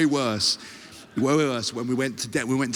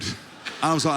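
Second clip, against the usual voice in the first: ordinary talk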